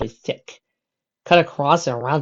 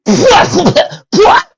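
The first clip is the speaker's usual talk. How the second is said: high laugh; 12 dB louder than usual. Acted. Laugh after avalanche.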